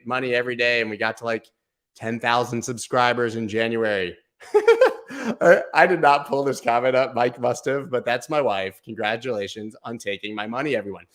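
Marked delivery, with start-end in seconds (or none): 4.5-5.4 burst of laughter. Real, not acted. Wife's comment pops up. 5.4-7.9 talking through real laughter, louder; laughing. Real, not acted. Streamer surprised by his wife's comment, laughing through words.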